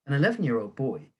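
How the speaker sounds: plain reading voice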